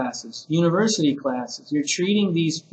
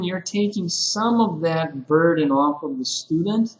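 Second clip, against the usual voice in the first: ordinary delivery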